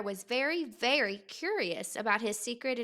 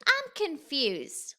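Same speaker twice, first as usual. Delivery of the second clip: slow puzzled; 4 semitones higher, slower than usual. A narrator's reading. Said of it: "said Little Bear".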